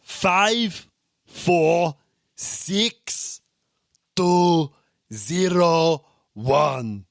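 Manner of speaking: loud, high-pitched German accent, digit by digit. Shown as a demonstration. Testing co-host on backwards digits, German accent. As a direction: bark out digits like a German examiner. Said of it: "do it in a German accent".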